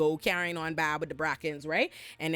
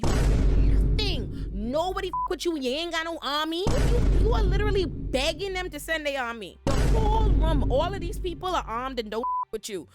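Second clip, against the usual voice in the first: heated rant; 6 semitones higher, 5 dB louder than usual. Real, not acted. Ranting at Daemon on screen.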